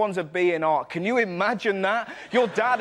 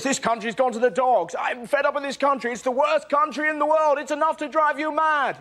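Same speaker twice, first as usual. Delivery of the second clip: whiny complaining; 6 semitones higher than usual. Retold or imitated. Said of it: "All you hear is".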